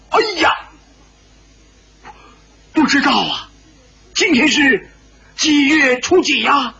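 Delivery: high-pitched alarmed outburst. A narrator's reading. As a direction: cry out in startled dismay, high. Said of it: "白自在也是大惊". (大惊失色地高声追问)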